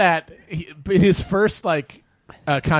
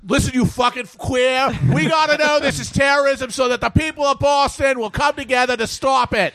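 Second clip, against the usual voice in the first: mock tough Boston cops; 8 semitones higher than usual. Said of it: "And they're like".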